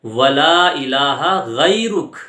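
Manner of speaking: ولا الہ غیرک drawn out with guttural غ; slow recitation. Shown as a demonstration. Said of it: "آگے تلفظ ہے".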